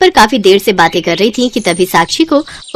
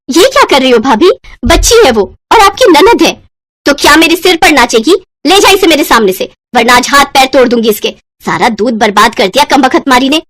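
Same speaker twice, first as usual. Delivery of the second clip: higher, louder angry confrontation; angry protest; 4 semitones higher, 3 dB louder than usual. Acted. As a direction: confront the bully in anger. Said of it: "उसने गुस्से से बबीता से कहा". (गुस्से में ऊँची आवाज़ में टोकना)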